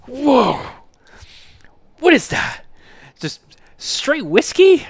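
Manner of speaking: high, loud, stunned disbelief; mock shock after a strong sip; imitating shocked dad.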